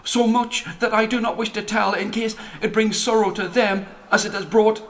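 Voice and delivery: a small timid comet searching for words; hesitant comet. Acted. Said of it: "that the comet spoke".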